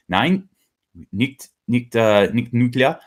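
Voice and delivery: a hint of a German accent, slow and firm; playing Germany saying no, lightly German; mock German refusal. Mock German refusal to Germany's own nuclear question.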